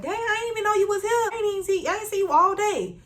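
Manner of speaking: high-pitched imitation of a surprised coworker; mock-surprised coworker. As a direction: gush it high, acting out her surprise. Voices surprised coworker in story.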